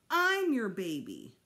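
loud, slow, stretched words; jealous child staking a claim; petulant insistence. A narrator's reading. Boy competing with baby sister, petulant insistence.

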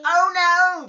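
loud high mock alarm; hammed-up oh no. A narrator's reading. Dad reading aloud, loud playful alarm. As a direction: belt it out like a cartoon. Dad's big story reaction.

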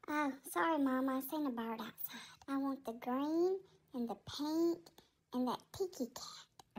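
low, soft worried pet voice; timid cat Tigger. Acted. Tigger picks food.

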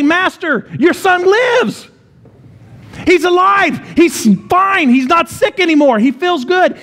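high, excited shout of good news; joyful shout. Retold or imitated. Servants calling out to their master, an excited shout.

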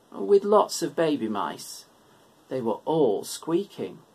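ordinary delivery, nothing marked

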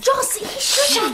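high, slow giggle through words; breaking into laughter; laughing. Acted. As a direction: laugh while speaking. Joss laughing.

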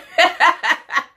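high, loud burst of laughter. Real, not acted. She laughs at her own pun, high and loud. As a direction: crack up at your own pun.

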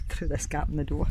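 ordinary talk

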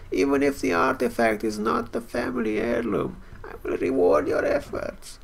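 put-on elderly quest-giver; old man voice. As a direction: read it as an old man asking for help. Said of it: "Old man voice".

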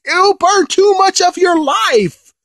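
very high, loud, slow frantic cry; alarmed warning shout. Retold or imitated. King Kai warning Goku, high frantic shout. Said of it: "King Kai is like".

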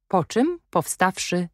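ordinary delivery, nothing marked

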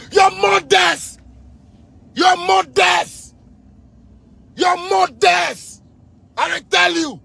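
high, slow, drawn-out angry yell; furious shout. Real, not acted. Cursing the officials who worry the youth, a drawn-out furious yell. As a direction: stretch every word out in pure rage.

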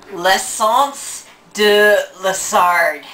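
slow, drawn-out L'Essence de Lessard in French; French accent. Shown as a demonstration. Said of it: "It sounds French".

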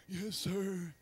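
soft, cowed little answer; hammed-up timid obedience; meek kid. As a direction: answer dad in a small soft voice.

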